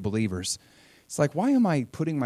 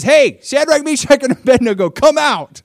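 much higher and louder, a long shout; calling out; 12 semitones higher, 13 dB louder than usual. Retold or imitated. Nebuchadnezzar shouting into the furnace for the three men to come out. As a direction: call them out across the roaring fire.

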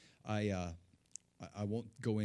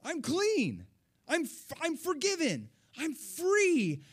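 much higher and louder, bursting with joy; joyful cry; 7 dB louder, slower than usual. Retold or imitated. Preacher reliving forgiveness, crying out in high joy. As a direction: shout it like a freed child. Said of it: "I was so happy".

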